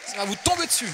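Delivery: high, loud proclamation; preacher's shout. Real, not acted. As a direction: shout the promise, punching TOMBER. Repeats the promise shouting.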